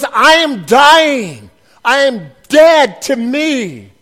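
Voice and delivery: very slow and loud, each word weighed; slow, weighty vow of surrender; slow solemn declaration. Acted.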